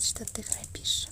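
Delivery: normal talking voice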